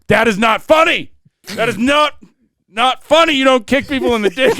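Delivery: high, loud scolding uncle; mock angry uncle. Uncle scolding the five-year-old, mock angry shout. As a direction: ham up a furious uncle. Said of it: "he was really mad".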